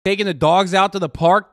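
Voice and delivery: normal talking voice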